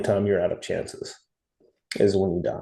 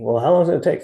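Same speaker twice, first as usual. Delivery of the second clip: high, loud mock whine of a question; mock impatient asker; 8 semitones higher, 5 dB louder, faster than usual. Mocking people who want a timeline, high exaggerated impatience. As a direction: mimic someone fretting, pitch climbing.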